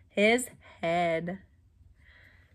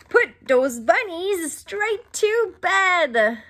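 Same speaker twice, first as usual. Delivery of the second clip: slow, higher and louder doctor order; 7 semitones higher, 4 dB louder, slower than usual. Acted. Doctor ordering the bunnies to bed, slow and high. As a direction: boom it out slowly like a bossy doctor. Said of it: "the doctor said".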